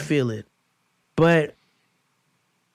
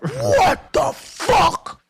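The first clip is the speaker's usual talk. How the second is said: strained whisper-yell, much higher and very slow; 12 semitones higher, 3 dB louder, much slower than usual. Retold or imitated. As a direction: hold back a shout so nobody wakes. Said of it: "I had to whisper, yell".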